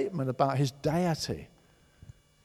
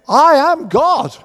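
slow, much higher and louder, every word hammered; proclaiming loudly; 11 semitones higher, 14 dB louder, much slower than usual. Acted. Preacher declaring Jesus's claim to the congregation, slow and loud. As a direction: boom it out as a declaration.

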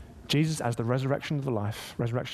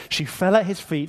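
ordinary talk, nothing marked

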